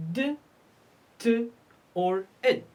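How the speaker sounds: "d", "t", "id" sounded out separately; three ed endings. Shown as a demonstration. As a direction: list "d", "t", "id" as sounds with clear gaps. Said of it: "E D is pronounced".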